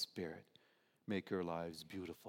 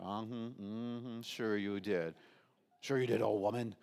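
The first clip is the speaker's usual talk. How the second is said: sarcastic. Retold or imitated. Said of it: "You know how people are".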